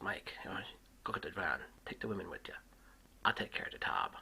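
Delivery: whispered scheming orders; conspiratorial whisper. Retold or imitated. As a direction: whisper the plan to your brother. Virgil plotting a dine and dash.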